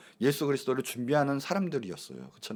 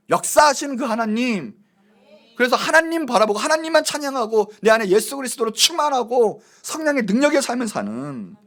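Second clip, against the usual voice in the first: high, loud, rousing pulpit cry; full-force preaching; 8 semitones higher, 7 dB louder than usual. Real, not acted. Preacher urging congregation not to confine God, full-force preaching. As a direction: build phrase on phrase with fervour.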